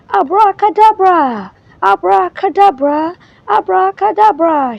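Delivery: high, loud repeated spell shout. Acted. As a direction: fling the magic word out three times. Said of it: "she shouted".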